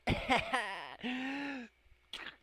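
loud, high cackle; high laugh trailing out; bursting laugh. Real, not acted. Laughs at the breakup news.

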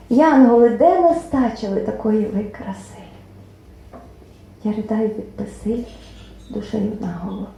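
poem recital. Acted. Poet reads to the hall, tender recital.

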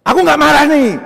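loud, high acted outburst; hammed-up angry shout; angry denial. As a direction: yell the denial like someone clearly furious. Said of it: "kok ngegas ngomongnya".

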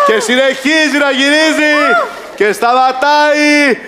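high, building game-show call; excited announcement. Pretend. Host calls the spinning wheel for contestant, high excitement. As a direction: pitch it up and stretch the final word.

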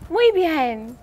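ordinary delivery

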